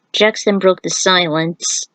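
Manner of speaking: plain reading voice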